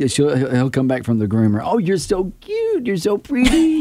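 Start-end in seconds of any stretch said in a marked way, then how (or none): 1.6-3.8 put-on baby talk. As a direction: coo over the dog. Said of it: "She's like".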